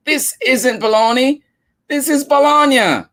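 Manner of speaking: put-on high, rising child protest; upset little girl. Nari complaining to her mom about the wrong bologna. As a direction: stamp your foot over the wrong lunch.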